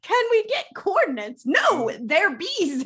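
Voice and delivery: high, loud hammed-up bickering; mock exasperation. Retelling two guys arguing about bees, in mock exasperation. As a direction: play both sides of a silly argument, loudly.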